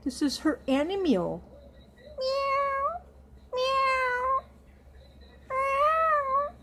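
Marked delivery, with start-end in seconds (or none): 2.2-6.6 very high, thin meows; kitten meow. Acted. Meowing for the doll's kitty, very high thin kitten mews. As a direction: mew like a tiny kitten.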